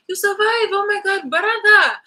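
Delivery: high, loud mock astonishment; mock surprise. Mocking the relative who sold them out, loud fake surprise. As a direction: ham up the fake shock of the betrayer.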